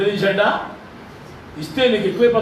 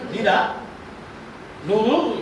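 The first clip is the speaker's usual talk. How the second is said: ordinary delivery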